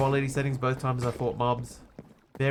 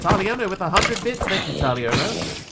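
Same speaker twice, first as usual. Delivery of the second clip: high, loud excited shout-out; excited thanks; 6 semitones higher, 5 dB louder than usual. Real, not acted. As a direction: cheer the donor's name, slowing on the thanks. Streamer thanking for bits.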